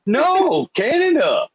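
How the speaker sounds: very high, put-on incredulous voice; hammed-up high-pitched disbelief; baffled Canadians. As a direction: imitate an indignant comeback way up high. Acts out baffled Canadians' reply.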